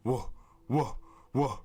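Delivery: imitating alien huffing whoas; mock huffing. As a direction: huff out the whoas like a beast. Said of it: "making a huffing sound like".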